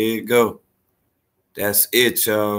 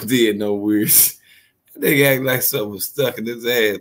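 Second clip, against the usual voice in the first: ordinary delivery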